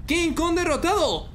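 ordinary talk, nothing marked

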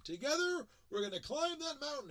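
high, loud rallying call to the group; rallying cheer. Retold or imitated. Presenter rallying the audience to climb the mountain, loud and high. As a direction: pump up the crowd like a coach. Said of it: "I tell everybody we're gonna climb a mountain".

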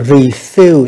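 refill slowly, weight on the second syllable; teacher's slow demonstration of refill; level first syllable, stressed second. Shown as a demonstration. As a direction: draw out refill, stressing FILL. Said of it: "đọc ngang".

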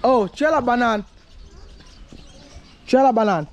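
high, loud call across the street. Real, not acted. Calling out to a girl vendor.

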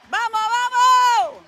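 high, loud, long drawn-out fan yell; cheering shout. Real, not acted. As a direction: holler it across the court, stretching the vowels. Cheering team at game. (grito de ánimo alargado)

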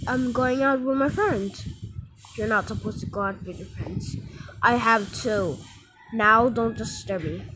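ordinary talk, nothing marked

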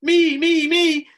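very high, loud eager shout; a crowd of kids begging to be picked; loud squealing me me me; children shouting. A narrator's reading. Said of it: "everyone shouted".

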